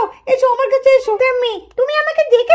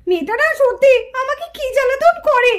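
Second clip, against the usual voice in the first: ordinary talk, nothing marked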